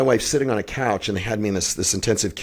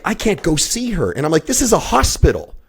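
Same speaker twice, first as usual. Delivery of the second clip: outraged high voice; 8 semitones higher than usual. Retold or imitated. Voicing outrage as the wife.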